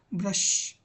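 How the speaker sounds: ordinary talk, nothing marked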